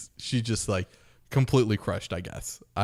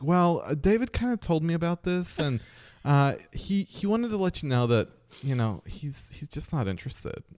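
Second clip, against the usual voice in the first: camp voice; 7 semitones higher than usual. Retold or imitated. Buddy telling her David isn't interested, camp voice. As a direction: let her down in a flamboyant voice.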